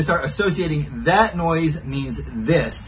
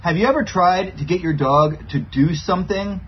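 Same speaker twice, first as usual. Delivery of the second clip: ordinary delivery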